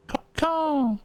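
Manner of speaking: high-pitched crow cry; crow caw. Acted. Player voices the crow between chats, high crow cawing. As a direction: caw it out high, dropping at the end.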